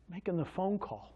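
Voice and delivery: usual speaking voice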